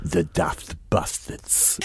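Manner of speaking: very slow, low and soft deadpan translation. Acted. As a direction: drag it out low for the punchline.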